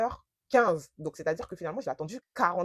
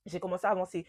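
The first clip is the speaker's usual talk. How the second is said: ordinary delivery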